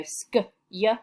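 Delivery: sk then y, split apart; sounded out. Shown as a demonstration. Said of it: "we could even sound that out".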